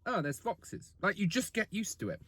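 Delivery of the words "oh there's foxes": much softer, bored shrug of a line; quiet blasé Londoner; unimpressed. Retold or imitated. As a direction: toss it off like it's nothing. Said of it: "It's like".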